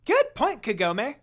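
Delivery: high, loud cheerful imp; happier. Acted. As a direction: perk up and squeak the praise. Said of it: "sounding happier".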